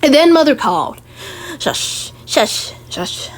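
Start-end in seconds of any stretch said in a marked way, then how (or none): 1.6-3.3 soft unvoiced shushing; hushing. Mother hushing her child, whispered shush.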